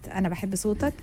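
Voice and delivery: ordinary delivery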